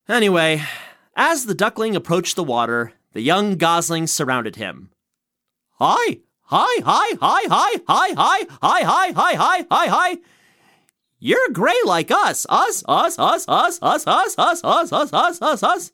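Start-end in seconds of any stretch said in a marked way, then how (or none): 5.8-15.9 high squeaky gosling chatter; silly clown. Acted. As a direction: chirp it like tiny goslings.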